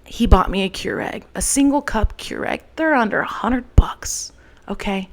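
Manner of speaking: ordinary talk, nothing marked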